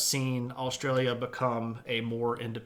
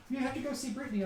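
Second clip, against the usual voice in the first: talking to puppy; 11 semitones higher, 3 dB quieter, much faster than usual. Real, not acted. Streamer gently sending the puppy to Brittany in baby talk. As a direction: send the puppy off sweetly. Telling dog to go.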